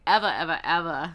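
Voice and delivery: loud, rapid-fire mock movie accent; comic accent, punch every repeat; Chris Tucker. Reader quoting a film guy to viewers, loud put-on accent. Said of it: "I try to copy".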